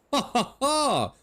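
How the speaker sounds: slow, high hammed-up villain cackle; a theatrical gentleman's evil laugh. Gentleman villain laughing at Miss Ellie.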